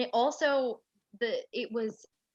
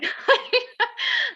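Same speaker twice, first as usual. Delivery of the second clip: teary laugh; 10 dB louder than usual. Retold or imitated. Laughing at her own tears.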